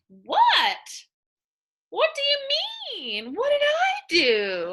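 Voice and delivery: very high, loud, baffled protest; shocked what. Acted. Teacher acting out a girl who claims she did nothing, in a high baffled voice. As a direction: squeal it like a wrongly blamed girl. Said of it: "So that's the word what".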